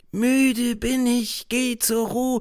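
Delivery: put-on high, slow children's prayer; a boy mumbling a prayer in fear. A narrator's reading. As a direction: recite the bedtime prayer slowly. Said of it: "prayer recited".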